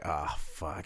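low, hushed dread; muttered dread. Retold or imitated. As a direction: sink into it quietly. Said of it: "I'm like".

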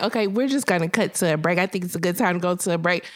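fast nervous host cutting away; hurried host. Retold or imitated. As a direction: rush to the commercial break. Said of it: "finally he's like".

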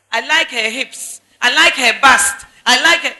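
mock loud, punchy list of likes; imitating eager brothers. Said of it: "The brothers".

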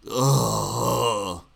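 long, drawn-out groan of disgust. Real, not acted. Sickened by a political slogan, disgusted groan. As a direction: groan as if nauseated.

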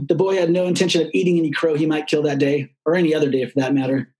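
ordinary delivery, nothing marked

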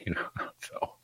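half-whispered joke. Real, not acted. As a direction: drop to a whisper. Joking aside about the dark.

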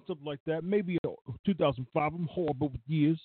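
playful imitation in a Red Hook accent; Taz, Red Hook accent. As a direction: read it like a Red Hook wrestler. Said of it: "I'll read it like Taz".

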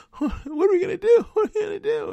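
very high, loud, crying whine; mock them as helpless crybabies, shrill and squealing; whiny mock panic. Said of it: "you're gonna show up and go".

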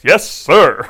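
emphatic, punched comeback in imitation; eager Phoebus. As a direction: blurt it out, loud and keen. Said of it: "and Phoebus says".

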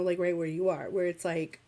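ordinary delivery

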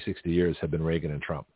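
ordinary talk

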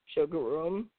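low, soft frog grunt; frog croak. A narrator's reading. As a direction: croak it low like an old bullfrog. Frog croaks his reply.

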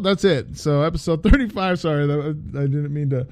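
ordinary delivery

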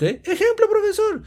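very high squeaky student voice; student piping up to the professor; eager student. Acted. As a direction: chirp it up high like a keen pupil. Teacher voices student asking example.

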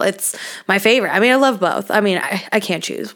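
ordinary talk

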